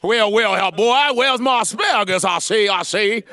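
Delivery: high, loud blustery cartoon rooster; hammed-up Foghorn Leghorn bluster. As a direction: boom it out like a southern cartoon rooster. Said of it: "Hey, Foghorn".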